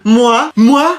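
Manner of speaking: eager pupil pleading. Acted. Eager pupil begging to answer.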